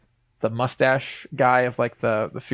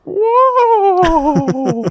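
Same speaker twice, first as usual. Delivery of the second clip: long, loud falsetto wail; very high, held comic cry; Mickey Mouse; 5 dB louder than usual. Retold or imitated. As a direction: squeal it out in a cartoon mouse voice. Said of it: "Mickey Mouse voice after that".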